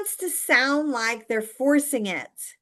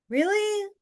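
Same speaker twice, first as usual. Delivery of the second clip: mock disbelief; 11 semitones higher than usual. Real, not acted.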